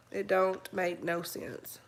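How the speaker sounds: whispered slowly under the breath; slow disbelieving whisper. Real, not acted. Repeats line, then whispers it.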